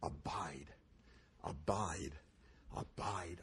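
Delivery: soft whispered abide; whispered prayer, repeated softly; whispered mantra. Shown as a demonstration. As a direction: breathe the word like a prayer. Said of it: "keep saying these words".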